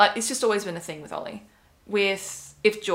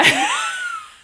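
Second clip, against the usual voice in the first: loud laugh; 7 dB louder than usual. Real, not acted. Laughs remembering the reason.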